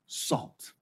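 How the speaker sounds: preacher hushing the key word for effect; whispered word. Acted. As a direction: breathe "salt." out as a soft whisper. Whispered final word of quote.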